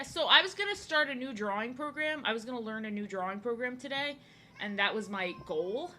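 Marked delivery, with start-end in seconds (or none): none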